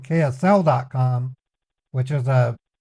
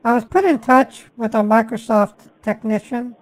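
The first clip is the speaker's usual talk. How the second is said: put-on caller voice; 5 semitones higher than usual. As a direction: play an innocent caller, pitched up. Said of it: "I have to literally pretend".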